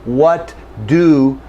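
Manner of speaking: "What do" with a pause between the T and the D; overly careful split; careful separate T and D. Shown as a demonstration. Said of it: "If I'm saying it very carefully".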